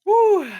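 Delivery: loud high hype whoop; excited whoop. Real, not acted. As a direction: cheer it out to kick off. Hype cheer before game one.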